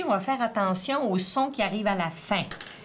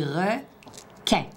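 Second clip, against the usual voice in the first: "requin" broken in two with a clear gap; "requin" as two separate beats; syllable split. Shown as a demonstration. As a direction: say "requin" slowly, one syllable at a time. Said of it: "dans la première syllabe ou dans la deuxième".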